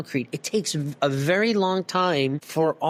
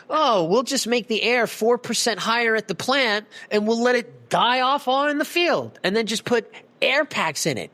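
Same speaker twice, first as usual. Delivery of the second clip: higher-pitched mock of a glib supplier; mocking imitation; 6 semitones higher than usual. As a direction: imitate their careless reasoning, pitched up. Mocking providers' careless quick fix.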